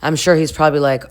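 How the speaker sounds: ordinary talk, nothing marked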